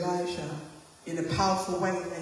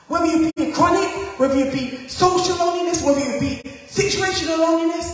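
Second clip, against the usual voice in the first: preaching build; 7 semitones higher, 6 dB louder, faster than usual. Acted.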